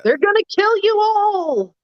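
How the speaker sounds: high shrieking mock panic; mock freak-out. Mocking a politician's hysterical warning, shrieked high.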